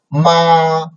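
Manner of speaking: MA held high and level; long MA, high and steady to the end; first tone. Shown as a demonstration. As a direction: say MA on a high, flat, sustained pitch. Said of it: "first tone".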